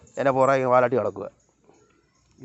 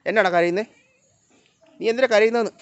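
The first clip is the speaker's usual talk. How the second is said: high, louder call to a pet; calling the cat; 8 semitones higher, 5 dB louder than usual. Real, not acted. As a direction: pitch it up and project it.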